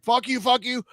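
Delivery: mock fury. Scammer cursing the man who wound him up, mock furious. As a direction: squeal the insult as a riled scammer. Imitating the enraged scammer caller.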